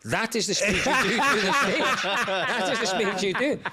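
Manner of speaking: high, slowly hammered-out demand; retold angry order. Passenger orders driver to slow down, angry insistence. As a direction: lay down the law, upset and insistent. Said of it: "I'm so angry".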